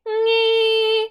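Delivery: high, slow nasal nge. Shown as a demonstration. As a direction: ring the nge in the nose. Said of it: "if you're gonna do an E".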